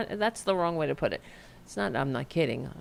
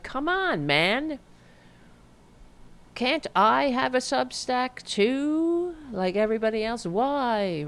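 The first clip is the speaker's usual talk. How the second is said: higher-pitched, drawn-out whine; whining complaint; 7 semitones higher than usual. Acted. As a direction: whine like you've been wronged. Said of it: "Like I'm whining and bitching and complaining".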